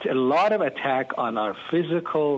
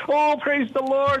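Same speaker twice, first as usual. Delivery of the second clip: much higher, bursting with joy; excited praise; 12 semitones higher than usual. Retold or imitated. Pastor reacting on air to the caller's healing, excited and much higher. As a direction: exclaim it like you can't contain the thrill. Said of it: "And here I was".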